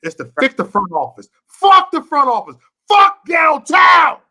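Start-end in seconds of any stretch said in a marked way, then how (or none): 1.5-4.2 higher and much louder, slow and hammered out; furious shout. Real, not acted. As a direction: yell it with everything, fed up. Passionate rant about his city.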